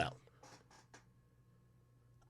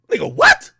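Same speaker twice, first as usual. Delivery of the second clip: shocked, pitched way up; disbelieving squeak; incredulous high what; 5 dB louder than usual. Real, not acted. Incredulous exclamation.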